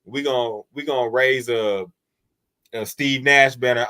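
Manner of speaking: normal talking voice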